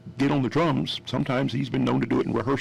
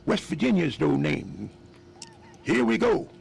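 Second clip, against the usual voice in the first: higher, louder, playful bandleader; 5 semitones higher, 3 dB louder than usual. Retold or imitated.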